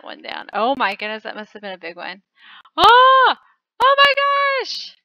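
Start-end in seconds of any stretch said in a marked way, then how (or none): 2.8-5.0 high, very loud yelp of alarm; startled shout. Real, not acted. Player nearly caught, high startled yelp. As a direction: jolt out a shocked cry. Near miss in game.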